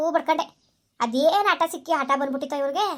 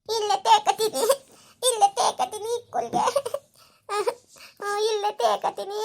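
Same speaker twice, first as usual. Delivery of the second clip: put-on high, slow child voice pleading with a giggle; little girl whining; 7 semitones higher, slower than usual. Daughter pleads with mother to stay home, high whiny child voice. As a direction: play a small daughter who won't leave.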